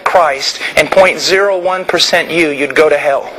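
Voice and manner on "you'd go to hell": ordinary talk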